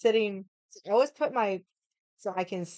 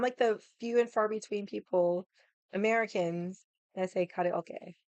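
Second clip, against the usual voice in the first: ordinary talk, nothing marked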